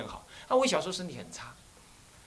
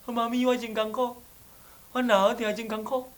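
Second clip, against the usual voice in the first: put-on sick child whining; 7 semitones higher than usual. Sick child complains to mother.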